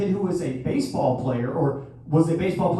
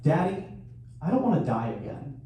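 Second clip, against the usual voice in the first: quoting daughter softly; 3 semitones lower, 7 dB quieter than usual. Retold or imitated. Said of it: "and I quote".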